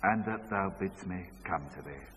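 father's hushed echo; quiet echo. Retold or imitated. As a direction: repeat it back subdued. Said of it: "say this after me".

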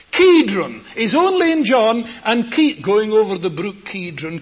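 high, loud fervent preaching; preaching at full force. Acted. Preacher booming about palm trees and Kidron, loud and high. Preacher expounding John with fervour.

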